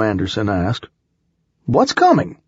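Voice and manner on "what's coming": high, raised-voice demand; alarmed question. A narrator's reading. Milo asking about the shouted warning, alarmed. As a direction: fire back the question, pitched way up.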